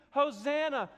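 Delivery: loud, high hosanna cry; preacher imitating the crowd's loud joyful hosanna; crowd shouting. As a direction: shout it like the palm-waving crowd. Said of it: "they're screaming".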